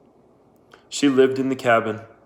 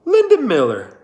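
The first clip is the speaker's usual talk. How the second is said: high, louder surprised question; surprised recognition; 12 semitones higher, 7 dB louder than usual. Acted. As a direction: recognize an old pupil. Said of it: "asked Kate".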